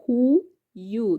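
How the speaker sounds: "Hu, yu" as two separate syllables; short break between Hu and yu. Shown as a demonstration. Teacher repeating the two syllables for learners, syllable by syllable. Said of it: "Those are two syllables".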